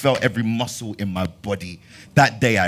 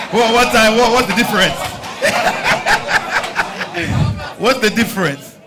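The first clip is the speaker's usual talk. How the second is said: high, loud, laughing and incredulous; laughing disbelief; 12 semitones higher, 6 dB louder than usual. Real, not acted.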